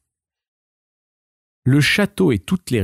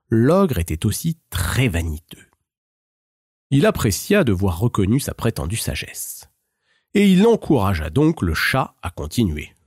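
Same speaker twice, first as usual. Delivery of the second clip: ordinary delivery, nothing marked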